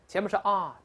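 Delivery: ordinary talk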